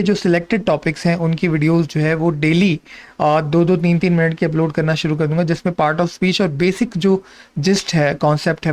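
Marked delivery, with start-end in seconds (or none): none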